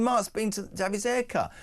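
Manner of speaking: teasing. Real, not acted. Teasing colleague about haircut.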